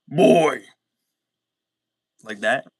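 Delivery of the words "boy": put-on shouted boy. Quoting a game character, shouting Boy at full volume. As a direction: bellow the word Boy.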